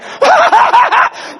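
a high shrieking laugh; wild whoop of laughter. Real, not acted. Preacher laughs as the plane blows up, high whooping laugh. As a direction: let out a big high cackle.

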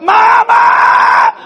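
put-on high, loud child's scream; boy just nailed to a stump, screaming; screaming for mama. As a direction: wail for your mother.

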